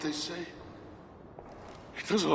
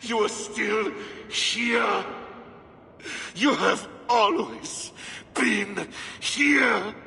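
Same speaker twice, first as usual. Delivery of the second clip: slow, rising fervent voice; growing ecstatic devotion; rising fervor; 8 semitones higher, 4 dB louder, much slower than usual. Acted. Rapturous confession.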